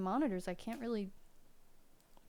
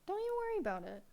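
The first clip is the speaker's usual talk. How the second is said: pet talk; 9 semitones higher, 3 dB quieter, faster than usual. Real, not acted. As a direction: coo reassurance to the cat.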